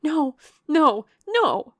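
very loud, high, frantic denial; wild cry. A narrator's reading. Distraught younger sister contradicting Eleanor, wild cry. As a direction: cry out wildly, climbing in pitch. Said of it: "cried Marianne wildly".

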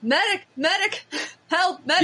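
high mock yell for a medic; retold yell. Recalling a fainting fan at a show, mock yell. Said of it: "I just yell".

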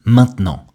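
"maintenant" with the middle E dropped; silent E. Shown as a demonstration. Teacher showing learners a dropped vowel, "maintenant" with silent E. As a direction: say "maintenant" in two syllables, main-t'nant. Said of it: "Le E de maintenant a complètement disparu".

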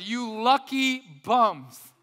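loud, high, drawn-out cheer; rousing congratulation. Acted. Preacher voices a paraphrased blessing to the congregation, rousing cheer. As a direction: slowly belt it out like happy congratulations. Acted congratulation to the poor in spirit.

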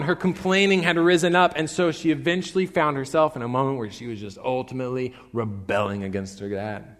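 normal talking voice

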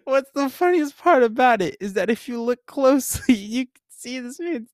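high, laugh-choked storytelling; crying with laughter. Real, not acted. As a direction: gasp out the story between real laughs. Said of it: "I'm crying from it".

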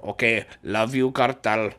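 ordinary talk, nothing marked

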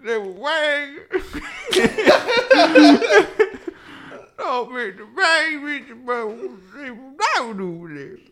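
put-on high, slow, wordless old man mumble, laughing through it; mock old man mumbling just noises.

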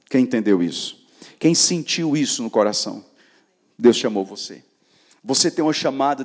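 loud preacher calling out to the youth; full-force preaching. Acted. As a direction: hammer it home to the crowd. (pregação inflamada em voz alta)

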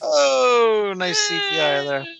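excited cry. Real, not acted.

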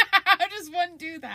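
laughing through the question; giggling while asking. Real, not acted.

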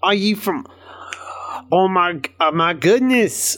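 high-pitched, badly faked Scottish guard; bad Scottish accent. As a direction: put on a horrible mock-Scottish voice. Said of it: "[name]'s opinion of a Scottish accent".

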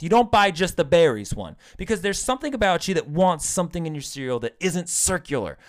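normal talking voice

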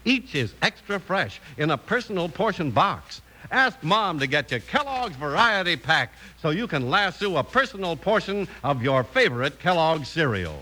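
ordinary talk

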